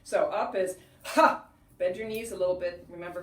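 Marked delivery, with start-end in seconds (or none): 1.0-1.4 short explosive "ha", much louder; forceful ha exhale. Shown as a demonstration. Teacher demonstrating a breathing exercise, forceful ha. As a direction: punch the breath out on one sharp ha. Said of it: "So it goes like this".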